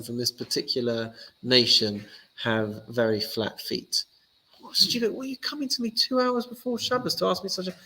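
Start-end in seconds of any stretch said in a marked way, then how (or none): 4.6-7.8 high, exasperated rant; irritated outburst. Acted. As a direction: let the annoyance burst out. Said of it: "That's not how Hillel responded".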